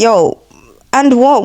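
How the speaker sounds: blunt, confrontational street greeting. Shown as a demonstration. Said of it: "I'm just like".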